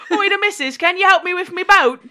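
loud, high hammed-up Cockney; mock Cockney accent. Host mocks Ted asking for boat help, broad Cockney accent. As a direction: bellow it like a caricature Cockney. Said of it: "It wasn't a Cockney accent either".

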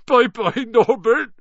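high, loud sobbing farewell. A narrator's reading. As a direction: wail goodbye through tears. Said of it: "Hagrid sobbed".

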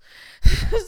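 sudden loud laugh; big real laugh; loud burst of laughter. Real, not acted. Laughs at own story.

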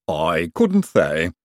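slow, drawn-out Igor voice; lightly voiced lisping servant. A narrator's reading. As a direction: answer very slowly, with a lisp. Igor answers in his lisping voice.

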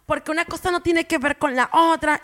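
high, loud exasperated protest; indignant retort. Retold or imitated. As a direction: snap back in disbelief. People online arguing back, quoted.

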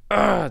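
high, loud mock groan of frustration; fed-up groan, acted out; exasperated groan. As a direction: imitate a fed-up "ugh". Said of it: "he's so fed up".

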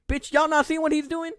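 high, loud incredulous challenge; acting out outrage at useless onlookers; retold indignant outburst. Retold yelling at staring crowd.

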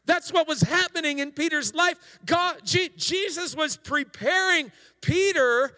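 high, loud, fervent sermon climax; full-force preaching. Real, not acted. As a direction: preach it out with rising fire.

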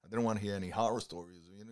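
ordinary delivery

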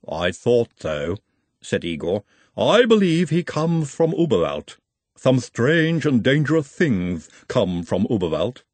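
measured, formal Igor voice with a foreign lilt. Acted. Igor speaks of Uberwald.

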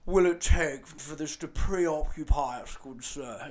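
slow, pompous toff voice, dragging each word; mock posh journalist. Mocking the journalist's fancy language, slow and pompous. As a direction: draw it out like a stuffy old gentleman. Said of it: "they use fancy language".

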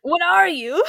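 squeaky, bewildered character voice; loud, high and baffled, imitating the hero; high-pitched baffled voice. Confused hero questions the witch.

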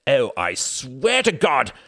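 slow, loud, high warning; angry threat. A narrator's reading. Reginald raising his hand at Wendy, threatening. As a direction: stretch the oath as if about to strike.

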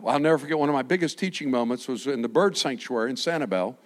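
usual speaking voice

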